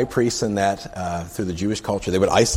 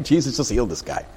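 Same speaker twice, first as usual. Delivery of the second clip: ordinary talk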